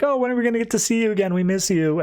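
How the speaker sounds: a touch of high, fast, eager wives' voices; eager wives. Retold or imitated. Wives on the phone begging Scandal to come home, lightly voiced high and fast. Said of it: "And they're both like".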